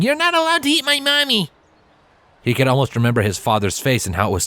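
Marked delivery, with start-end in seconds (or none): 0.0-1.5 put-on high child's voice, shouting bravely; little colt yelling. A narrator's reading. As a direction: yell like a tiny knight defending mommy.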